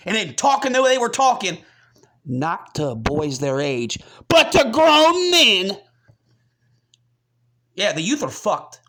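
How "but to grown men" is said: "grown men" slow and much louder, drawn out; stress on grown men. Real, not acted. Outraged emphasis in rant.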